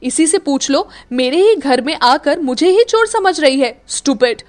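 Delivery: fuming at being taken for a thief; angry complaint. Acted. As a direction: vent at his sister. Akshat complains furiously.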